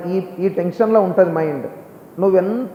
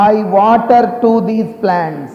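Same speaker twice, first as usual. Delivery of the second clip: slow, clear model; much slower than usual. Shown as a demonstration. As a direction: stretch the sentence out for all to hear. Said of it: "అందరు ఇక్కడ చూడు". (నెమ్మదిగా, కొంచెం గట్టిగా, పదం పదంగా)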